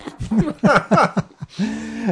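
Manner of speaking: bursts out laughing, high; a real, free laugh. Real, not acted. Laughing at the fish price.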